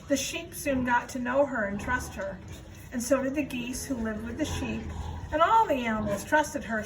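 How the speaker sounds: usual speaking voice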